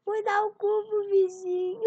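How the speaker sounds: high, loud tearful whine; hammed-up sobbing child, high and loud; mock crying. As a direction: wail it like a bawling little boy. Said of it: "O menino chorando responde".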